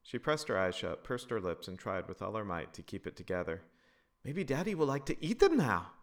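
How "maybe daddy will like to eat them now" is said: put-on high loud child's voice; higher louder kid voice; little girl. A narrator's reading. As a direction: say it like a hopeful four-year-old. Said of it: "Loretta said".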